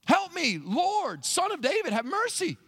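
high-pitched mock plea for help; imploring, sing-out appeal in short bursts; acted begging. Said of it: "She's begging for help".

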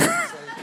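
quick squeaky giggle, very high; short high laugh. Real, not acted. Pastor laughing at himself for forgetting prayers, squeaky giggle. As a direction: let a high little laugh escape.

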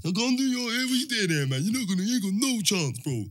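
high-pitched mocking dismissal; imitated jeering. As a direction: imitate rivals writing you off. Rivals dismissing his chances in story.

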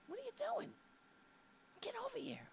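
very high, soft whispered coaxing. Real, not acted. Host whispering to coax her shy pet over. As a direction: whisper him over gently.

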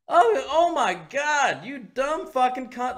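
high, drawn-out exasperation; exasperated outburst. Retold or imitated. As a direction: wail it slowly in retold outrage. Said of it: "it's my frustration".

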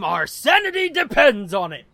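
loud, hammed-up sign-off plea; mock desperate plea. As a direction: push it out loud, playfully desperate. Joking plea to return.

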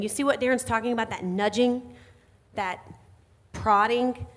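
usual speaking voice

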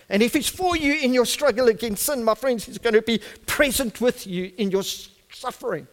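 high-pitched preaching building to a loud climax; full-force preaching. Real, not acted.

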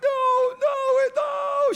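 put-on high, loud little girl's wail; wailing toddler no. Grandpa mimics his granddaughter's wail over the sandcastle, high and loud. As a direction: cry out like a heartbroken little child.

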